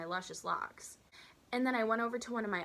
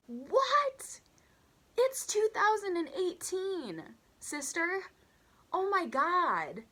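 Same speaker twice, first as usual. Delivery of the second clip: very high, loud, stretched-out outrage; shrieking disbelief; 11 semitones higher, 6 dB louder, much slower than usual. Real, not acted. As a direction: screech it slowly, utterly scandalised. Teller outraged at racist remark.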